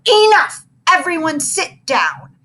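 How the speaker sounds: loud, high roar at the class; teacher roaring at rowdy children. A narrator's reading. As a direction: shout the room into silence. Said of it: "Mister Jupiter roared".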